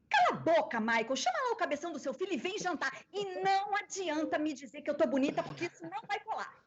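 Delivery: high, scolding sitcom wife dub; Jay. Shown as a demonstration. Dubber performs wife scolding husband on request, high and bossy. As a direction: rattle off an exasperated telling-off, pitched well up. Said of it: "Você consegue dublar a J K ou alguma frase".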